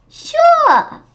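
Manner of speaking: bright squeal of a yes; eager high Sure. Acted. Teacher answering a kid's question, eager squeal. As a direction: chirp it high and loud.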